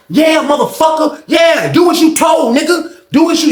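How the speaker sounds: high, jeering holler; taunting tirade. Retold or imitated. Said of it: "he talking to him like that".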